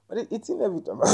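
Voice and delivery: talking through a mouthful of neem; words round a chew; chewing while talking. Real, not acted. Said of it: "I'm chewing on some".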